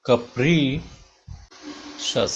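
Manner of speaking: capricious drawn out slowly, with a long held PRI and a gap before SHUS; slow teaching breakdown of "capricious", ca-PREE... shus; broken into syllables. Shown as a demonstration. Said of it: "then it will rise at p".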